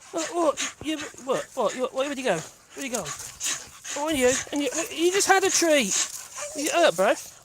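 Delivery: high-pitched fussing at a pet; talking to the dog. Real, not acted. Owner asks returned Wally where he went, high pet talk. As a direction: chatter excitedly to a returning dog.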